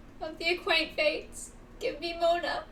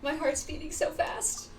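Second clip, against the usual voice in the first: ordinary talk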